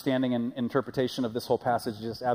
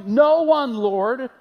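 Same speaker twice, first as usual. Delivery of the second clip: much higher, louder, desperate cry; woman pleading; 11 semitones higher, 9 dB louder, much slower than usual. Acted. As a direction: cry it out, trembling with relief. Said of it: "she answered".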